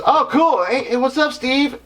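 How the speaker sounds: high, breezy rock-star greeting; lightly voiced rock star greeting a fan. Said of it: "So [name] goes".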